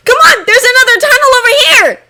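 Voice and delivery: very high, fast, loud shout; urgent calling out. A narrator's reading. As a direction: yell to your friends to hurry along. Child calls out to companions.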